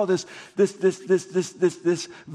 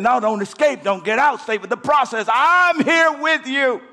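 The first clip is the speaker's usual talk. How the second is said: preaching God's voice; 6 semitones higher, 4 dB louder than usual. Retold or imitated. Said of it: "God is saying".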